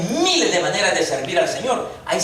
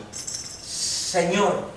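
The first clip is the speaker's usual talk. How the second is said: a hushed, unvoiced Señor, softer; trembling and fearful; 6 dB quieter than usual. Saul on the road, whispering Señor in fear. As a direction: breathe it out like a frightened man. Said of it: "él temblando y temeroso dijo".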